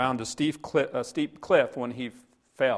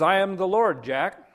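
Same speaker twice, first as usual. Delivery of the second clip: loud, raised declaration; booming announcement; 7 semitones higher, 7 dB louder than usual. Acted. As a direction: announce yourself from on high. Voice from above announces itself.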